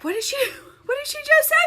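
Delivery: high, baffled aside; mimicked bewilderment. Old man beside her in yoga class, baffled. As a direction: ask it high, as a lost classmate.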